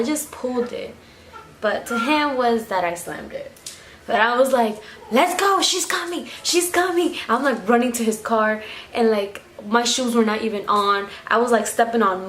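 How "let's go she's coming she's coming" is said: high, hurried urging; urgent panic. Retold or imitated. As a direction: push him to run before mom arrives. Urging friend to flee mom.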